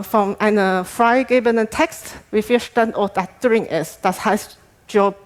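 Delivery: ordinary delivery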